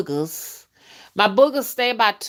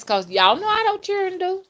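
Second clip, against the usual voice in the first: knowing; 9 semitones higher than usual. Real, not acted. Knowing remark about kids.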